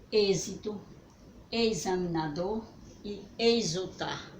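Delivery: normal talking voice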